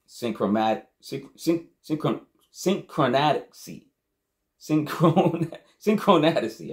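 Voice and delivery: tripping over a hard word, pitch climbing; real stuttering stumble. Real, not acted. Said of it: "just stuttering".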